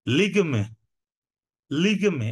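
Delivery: লিগমে as two syllables, lig-me, no vowel after g; ligme, not ligami. Shown as a demonstration. Teacher correcting students' Portuguese, clipped two-syllable লিগমে. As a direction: say লিগমে short: lig then me. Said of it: "এটা কিন্তু লিগামি না".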